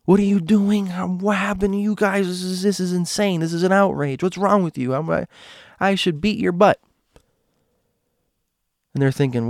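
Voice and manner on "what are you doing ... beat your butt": angry yelling mother. Retold or imitated. Neighbor girl's mother yelling at the kids on the driveway, high angry yell. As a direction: scold them like a furious mom. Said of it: "yelling at her and Dink".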